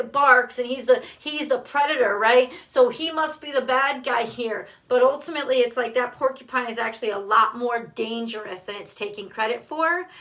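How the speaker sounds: ordinary talk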